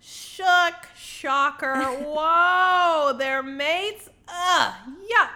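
high, loud, hammed-up astonishment; exaggerated scandalised reaction; mock shock. Mocking reaction to the mate reveal.

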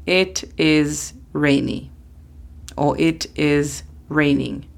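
It is rainy said slowly and clearly; slow model sentences. Shown as a demonstration. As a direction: spell out the example gently. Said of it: "la reemplazamos con it".

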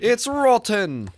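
slow, high mock chef verdict; Gordon Ramsay impression. Pretends Gordon Ramsay judges the cake, slow and high. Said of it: "Next week we'll have Gordon Ramsay".

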